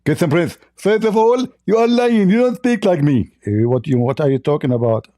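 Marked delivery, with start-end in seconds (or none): 0.0-3.3 high-pitched, lisping mock debater; Zakir Naik. Host mimicking his debate opponent, high lisping impression. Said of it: "I can speak like him".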